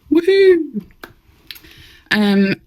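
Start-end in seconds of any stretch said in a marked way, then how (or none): 0.1-0.8 high whooping cheer; excited. Real, not acted.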